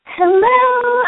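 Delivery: high, loud excited hello; excited greeting. Real, not acted. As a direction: greet them with a burst of joy.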